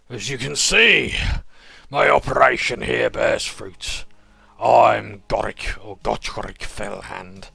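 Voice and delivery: loud, slow, menacing crime boss; smug sewer kingpin with a Dudley accent. Acted. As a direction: lean in like a gangster offering a deal. GM voices the Sewer King.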